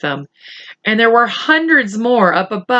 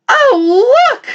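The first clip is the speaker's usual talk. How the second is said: much higher, louder cry; shouted; 5 dB louder than usual. Acted. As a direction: squeal oh look. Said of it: "shouted the ladybug".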